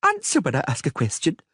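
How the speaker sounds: put-on high girlish voice, bossy and demanding; a child trying to sound threatening; mock menacing girl. Little princess ordering the Witcher to answer, mock menace. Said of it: "she said menacingly".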